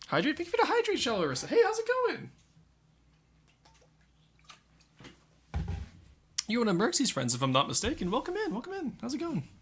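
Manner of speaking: bright high streamer welcome; cheerful greeting. Real, not acted. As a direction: greet the viewer with big energy. Greeting chat viewers.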